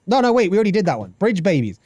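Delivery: ordinary talk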